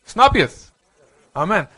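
high, loud challenge to the room; preaching shout. Real, not acted. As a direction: fire the question at them. Preacher calls out to congregation. (luid uitgeroepen vraag)

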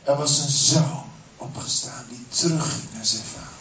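usual speaking voice

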